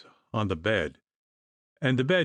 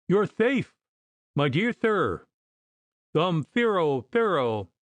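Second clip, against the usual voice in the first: hysterical lisping relief; 7 semitones higher, slower than usual. A narrator's reading. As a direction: tumble the words out with a lisp. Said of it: "shaking his hand hysterically".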